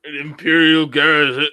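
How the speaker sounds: put-on loud, booming old villain voice; Darth Vader. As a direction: boom it like an aging dark lord. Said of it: "I need Old Man Vader voice again".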